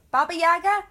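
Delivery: loud questioning call, rising. Acted. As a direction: call the name across the room. Calling for the next guest.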